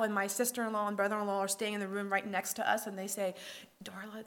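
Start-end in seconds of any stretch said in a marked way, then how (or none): 3.8-4.2 soft, hushed call of a name; hushed name, careful not to be overheard; quietly. Retold or imitated. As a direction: say the name quietly so no one hears. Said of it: "they say to me quietly".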